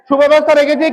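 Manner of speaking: normal talking voice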